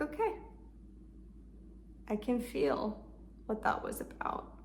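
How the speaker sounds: comforting self-talk. Shown as a demonstration. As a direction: hold her with your words. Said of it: "Just holding her and comforting her".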